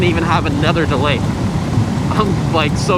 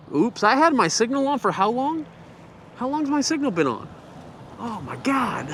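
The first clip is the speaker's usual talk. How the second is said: higher, softer startled realization; surprised; 6 semitones higher, 7 dB quieter than usual. Real, not acted. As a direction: caught off guard, half laughing. Notices turn signal left on.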